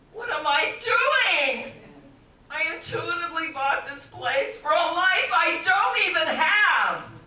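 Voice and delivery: loud, high despairing wail; acted distraught outburst. Frances crying to Signor Martini, acted anguished outburst. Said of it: "She's crying".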